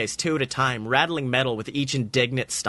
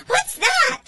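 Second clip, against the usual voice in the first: very high squeaky little creature calling; shrill tiny tin-can critter; tiny robot voice; 3 dB louder than usual. A narrator's reading. Tin can robot tugging Jesse's leg, high squeaky call.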